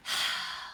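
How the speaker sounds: soft, breathy sigh; heavy sigh. Real, not acted. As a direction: let out a long exhale after the story. Pause after recapping the book's ending.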